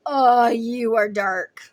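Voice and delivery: loud, drawn-out recoil; mock revulsion. Your energy recoiling from a dark person, loud mock revulsion. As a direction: groan it slowly, hammed-up disgust.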